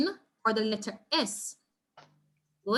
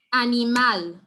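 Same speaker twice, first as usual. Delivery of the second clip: "Animal" said loudly, final MAL hit hard; stress on MAL; 6 dB louder, slower than usual. Shown as a demonstration. Teacher repeating the drill, "Animal" stressed on MAL. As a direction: repeat a-ni-MAL with a punched ending. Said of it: "on the syllable that is stressed".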